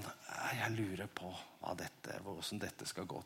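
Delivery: very soft, low private musing; thinking to himself. Acted. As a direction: murmur it as if wondering alone. Voicing own thoughts while painting. (lavmælt grubling for seg selv)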